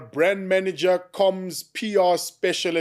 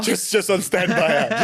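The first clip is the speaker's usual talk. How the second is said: laughing; 4 semitones higher than usual. Real, not acted. Laughing at bomb squad joke.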